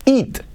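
"Eat" said like "et"; short E vowel in "Eat"; "Eat" as "et", not "it". Shown as a demonstration. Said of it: "No es it, es et".

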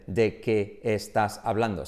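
slow, separated "¿De qué estás hablando?"; spaced-out words in "¿De qué estás hablando?", no linking. Shown as a demonstration. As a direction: give each word of "¿De qué estás hablando?" its own beat. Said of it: "No dicen".

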